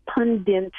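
"pundit" with an extra n, PUN-dint; non-standard pronunciation. Retold or imitated. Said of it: "He sticks an N in there".

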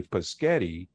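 "paschetti" said puh-sketti; child's mispronunciation. Shown as a demonstration. Linguist recalling how kids would say it, "paschetti" as puh-sketti. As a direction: move the s in "paschetti" to after the first syllable. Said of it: "same way that kids".

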